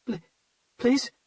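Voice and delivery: high, halting stammered please; weak, broken plea. Acted. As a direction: stammer it out weakly in fear. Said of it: "His voice came out weak and in spurts".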